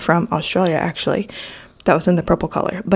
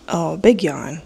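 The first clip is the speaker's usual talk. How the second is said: ordinary talk, nothing marked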